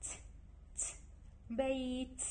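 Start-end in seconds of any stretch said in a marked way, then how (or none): 0.0-0.8 ت said alone, crisp and brief; isolated t sound. Shown as a demonstration. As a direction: tap out ت on its own. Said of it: "لأن أني لفظتها".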